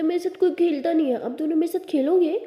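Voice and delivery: put-on high, loud little-kid complaint; little brother. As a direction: plead like a child wanting playmates. Little brother asks them to play.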